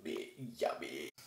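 ordinary talk, nothing marked

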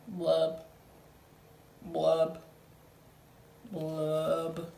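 low bubbly blub, blub, blub; gloomy fish blubbing. Acted. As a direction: blow the bubbles low and slow.

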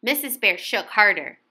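plain reading voice

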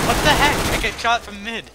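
high, loud startled yell; real disbelief at getting shot; shocked outburst. Real, not acted. Shot unexpectedly in game.

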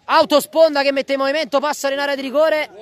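rising excitement. Real, not acted. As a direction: push the attack with energy. Attack builds into the box. (telecronaca concitata)

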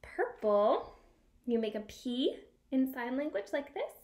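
normal talking voice

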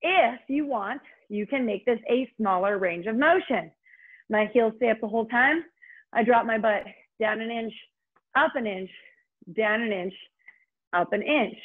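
ordinary talk

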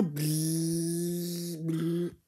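a mouth-made electric buzz; droning buzz sound effect; buzzing machine noise. Acted. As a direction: imitate a zapping machine.

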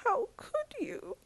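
high, breaking cry of despair; tearful high plea; crying moan. A narrator's reading. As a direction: wail it softly through tears. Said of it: "she moaned".

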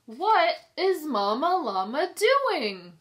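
ordinary delivery, nothing marked

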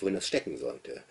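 ordinary delivery

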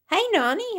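put-on very high-pitched child's greeting; imitated little child. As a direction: call out like a small grandchild.

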